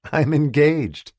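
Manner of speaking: proud, high and loud, slow; announcing it with pride; proudly. A narrator's reading. Sabrina telling her old friend the news, proudly. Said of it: "she said proudly".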